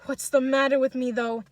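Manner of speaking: forceful baffled question; astonished. A narrator's reading. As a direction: demand it of yourself in bewilderment. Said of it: "deeply astonished".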